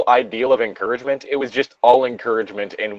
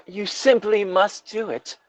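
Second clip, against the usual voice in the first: slow, higher, soft dramatic plea; urging; 9 semitones higher, 5 dB quieter, slower than usual. Acted. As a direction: urge a friend theatrically, drawing it out. Acting out fan fiction dialogue.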